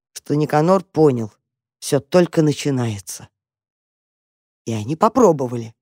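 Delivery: ordinary delivery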